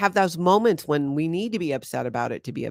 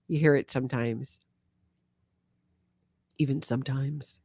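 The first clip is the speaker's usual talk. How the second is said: normal talking voice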